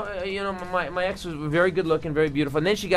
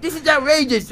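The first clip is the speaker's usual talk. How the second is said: silly outraged voice; 9 semitones higher, 3 dB louder than usual. Pretend. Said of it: "did you ever use that voice".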